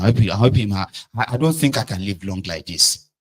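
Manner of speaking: usual speaking voice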